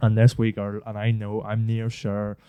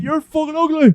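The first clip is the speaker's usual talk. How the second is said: high, mocking girly voice; mock mean girl. Lad sending up the girls' claim, high mock girly voice. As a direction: send up the insult, high and snide.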